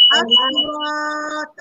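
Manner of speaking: "asurat" slow, with a strong bounce on the final t; qalqalah kubra demo. Shown as a demonstration. Teacher showing the students a strong qalqalah bounce at the word's end. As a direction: stretch "asurat" and bounce the last t hard. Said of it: "kalau ta ti di hujung kalimah".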